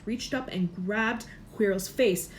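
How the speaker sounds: ordinary delivery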